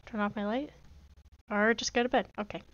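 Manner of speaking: ordinary talk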